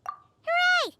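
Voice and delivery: high, bright hooray; cheer. Acted. Paimon cheering for her friends, high and excited. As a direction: throw up a happy cheer.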